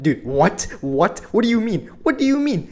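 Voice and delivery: can't believe what he just heard; rising stunned outrage; incredulous what. Retold or imitated. Stunned by suspension news.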